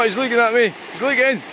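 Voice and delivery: teasing the heron in a high cooing voice; high pet talk. Real, not acted. Talking to the watching heron.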